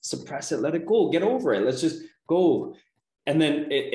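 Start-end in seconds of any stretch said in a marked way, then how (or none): 0.0-2.7 dismissive brush-off. Retold or imitated. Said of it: "we're always dismissing it".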